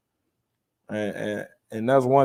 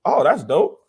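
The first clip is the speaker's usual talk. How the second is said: higher-pitched, wowed discovery; re-enacted delight; 5 semitones higher, 5 dB louder than usual. Recalling himself reading the dictionary for fun, delighted. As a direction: play back a kid's amazed find.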